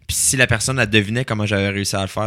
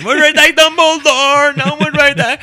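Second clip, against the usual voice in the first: put-on high excited child voice; kid voice; 6 dB louder than usual. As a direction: play a giddy little kid. Kid acting out Harry Potter house choice.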